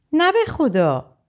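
a slow high "no, I swear"; high pleading protest. A narrator's reading. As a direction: plead it, drawn out. Narrator's own reply, pleading.

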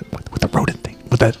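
whispered scolding forehead to forehead; urgent whisper. Acted. Boris whispering to his sister, urgent whisper. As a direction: hiss it close so the squirrels can't hear.

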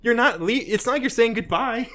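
worked-up, indignant squawking; exasperated rant. Acted. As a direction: scold them in mock disbelief. Mock rant at the kissing couple.

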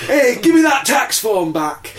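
high, slow mock protest; mock Gary. Host voicing Gary grabbing back his tax form. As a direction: whine it like a caught tax dodger.

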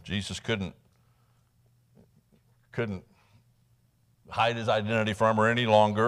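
ordinary talk, nothing marked

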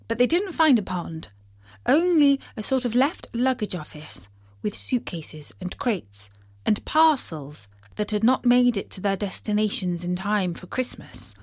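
plain reading voice

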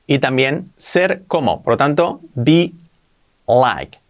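usual speaking voice

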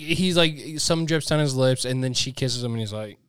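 ordinary talk, nothing marked

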